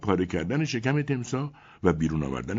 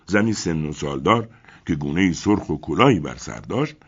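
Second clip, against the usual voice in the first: ordinary delivery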